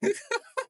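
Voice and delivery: high squeaky laugh; real laugh breaking out; laughing hard, high-pitched; burst of laughter. Real, not acted. Cracks up after a clip.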